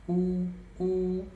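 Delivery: high, loud drawn-out train horn; train horn imitation. Shown as a demonstration. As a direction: stretch both syllables like a train whistle. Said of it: "Esse u, ele imita aquele som". (imitando a buzina do trem, alto e esticado)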